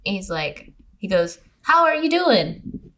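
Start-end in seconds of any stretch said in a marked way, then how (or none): 1.6-2.9 high, slow mimicked small talk; mock casual greeting. Friend imitating her date dodging the question, mock casual. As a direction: stretch it out, mocking his greeting.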